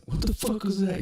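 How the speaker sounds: high, strained mock alarm; retold alarmed reaction. Retold startled reaction to crash.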